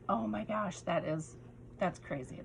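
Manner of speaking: ordinary talk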